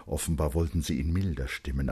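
plain reading voice

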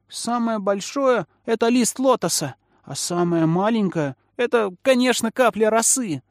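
put-on high-pitched little kid answering; child's voice. A narrator's reading. Child answering Feiyu's riddle, high childish voice.

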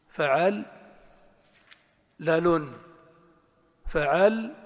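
فعل as fa'al, long pause, لال as lalun; syllable-by-syllable. Shown as a demonstration. Teacher trains the ear, syllables set apart. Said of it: "ومعرفة المقاطع الكلمات".

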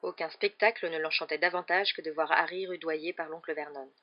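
ordinary delivery, nothing marked

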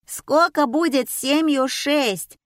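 high, slow, called-out question; shouted question. A narrator's reading. Audience member heckling the assistant, shouting. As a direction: yell it from the back rows. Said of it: "закричали из зала".

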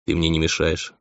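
understated cool indifference; cold, distant. A narrator's reading. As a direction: answer absently, with little warmth. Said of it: "холодный".

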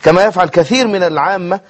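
ordinary delivery

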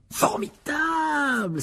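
very high, long-drawn cry of delight; thrilled exclamation. Acted. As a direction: stretch the word out in awe. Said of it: "s'exclamèrent Fred et George". (exclamation enthousiaste et étirée)

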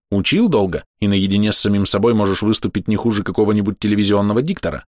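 plain reading voice

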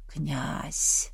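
faint, low call from far off; calling softly from a distance; distant call. Acted. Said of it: "донёсся знакомый голос до его сознания".

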